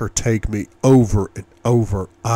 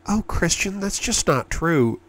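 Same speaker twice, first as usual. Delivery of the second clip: protesting woman; 8 semitones higher than usual. A narrator's reading. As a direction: lift it high and gently brush him off. Woman's protest voiced by narrator.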